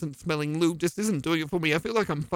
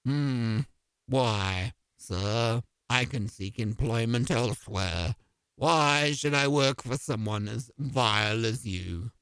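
haughty low butler; 9 semitones lower, 3 dB quieter than usual. Acted.

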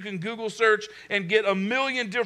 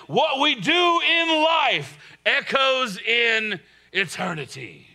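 Russell Crowe Gladiator voice; slower than usual. Retold or imitated. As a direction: declare it like a battlefield general. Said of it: "That's my best Russell Crowe, uh, Gladiator voice there".

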